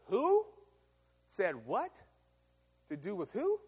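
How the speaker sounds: a sharp, high, loud first "Who?"; scoffing disbelief. Acted. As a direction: sneer at the demand. Pharaoh scoffs at message.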